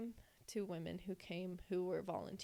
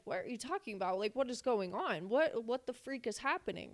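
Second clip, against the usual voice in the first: higher, louder rushing questions; panicked disbelief; 5 semitones higher, 5 dB louder than usual. Retold or imitated. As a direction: demand answers in rising panic. Her own panicked reply.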